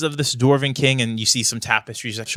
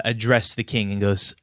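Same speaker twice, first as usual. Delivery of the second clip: ordinary talk, nothing marked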